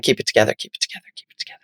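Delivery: quiet then fading to a fast whisper; whispered to herself. Retold or imitated. She whispers to herself while he describes crying. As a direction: whisper it to yourself, holding back tears. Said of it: "I was like".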